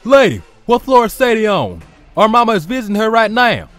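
urgent demand. Acted. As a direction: demand it at once from the receptionist. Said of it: "Clyde demanded".

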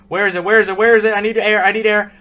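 high, loud frantic pleading; panicked. Real, not acted. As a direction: gasp for air in panic. Panicking, running out of air.

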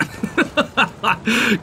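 high-pitched chuckling; self-mocking laugh. Real, not acted. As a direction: laugh at your own bad play. Laughing at own failure.

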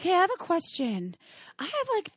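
high, hesitant caller; halting, high-voiced confession; friend calling with a question. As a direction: imitate a friend making an awkward confession, pitched high. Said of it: "friends now that call me".

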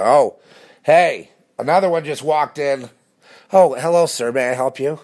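high, loud, sing-song fake-friendly clerk voice; mock clerk greeting. Mocking the store clerks greeting a shopper. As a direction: ham up a sugary shop greeting.

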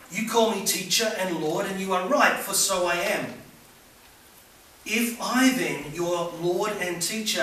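loud, high, fervent gospel reading; preaching at full force. Acted. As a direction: proclaim it boldly to the congregation. Preaching to the congregation.

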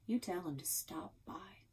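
soft threatening whisper; menacing whisper. Acted. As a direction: whisper it like a warning. Sheriff menacing quiet line.

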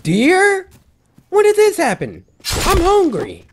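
loud, very high exclamation; high-pitched eager outburst; excited surprise. Real, not acted. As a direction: squeal at an unexpected sighting. Spots deer in game.

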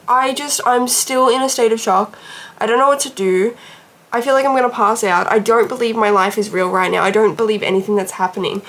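ordinary talk

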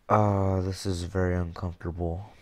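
usual speaking voice

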